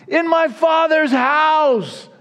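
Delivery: high, slow, ringing shout on my Father's house; preaching shout. Acted. Preacher crying out Jesus's promise to frightened disciples, shouting. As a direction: lift it to a soaring preacher's cry.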